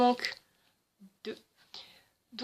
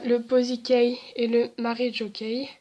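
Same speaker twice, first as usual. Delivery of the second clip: normal talking voice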